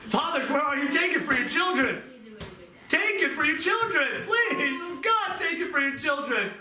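high, pleading altar call with a cry in the voice; impassioned preaching. Acted. Preacher urges parents and children to take the abuse, impassioned pleading. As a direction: beg fathers and children to take it.

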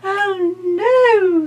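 very high, loud frightened fly cry; housefly. Acted. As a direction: squeal it out in alarm. Fly cries out.

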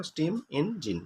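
teacher separating "স্টিম ইঞ্জিন" into three beats; broken into three parts. Shown as a demonstration. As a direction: softly sound out "স্টিম ইঞ্জিন" in three pieces. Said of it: "তিনটে পার্টে ভাঙা হয়েছে".

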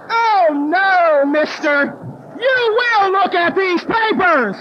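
loud, slow, drawn-out scolding; indignant. Retold or imitated. Woman confronts border officer over her papers, indignant. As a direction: lay down the law, indignantly. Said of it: "I even had my little sponsee indignant".

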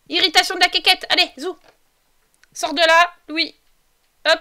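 loud, high orders; bossy shouting. Pretend. Ordering the Sims about.

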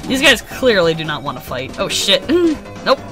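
ordinary talk

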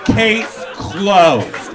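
loud mock lawyer verdict with a laugh. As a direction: declare it like a cocky lawyer resting his case. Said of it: "Like a lawyer".

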